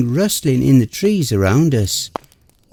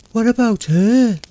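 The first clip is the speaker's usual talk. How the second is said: higher distressed plea; cried; 9 semitones higher than usual. Acted. Girlfriend protests leaving Amanda, distressed cry. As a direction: plead for the dead friend. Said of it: "Mara cried".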